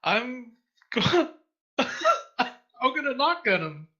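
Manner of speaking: high, loud, giggly declaration; gleeful announcement. Real, not acted. Player declaring a big attack to the table, gleeful announcement. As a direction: draw it out high and loud, breaking into a laugh.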